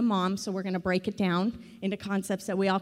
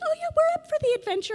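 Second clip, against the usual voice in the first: much higher, fast, chirpy mock cheer; breezy elderly parents; faster than usual. Parents in their seventies agree to move, in a chirpy high mock-cheerful voice. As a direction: parody carefree enthusiasm. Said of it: "They're like".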